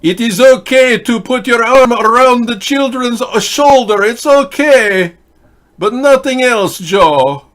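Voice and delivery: high, loud, slow mock pontiff; solemn squeaky papal decree; Pope voice. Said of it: "if the Pope could just say in Latin".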